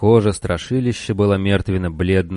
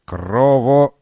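high, creaky monster call; creaky inhuman voice; 5 semitones higher, slower than usual. Acted. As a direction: croak it out like a beast. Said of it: "скрипучий нечеловеческий голос".

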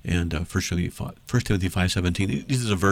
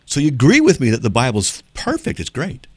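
mock agreement; 6 semitones higher, 5 dB louder than usual. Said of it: "And then I'm gonna say".

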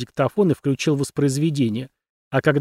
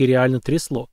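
usual speaking voice

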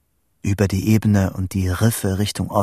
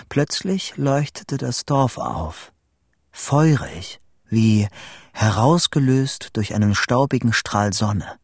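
ordinary delivery

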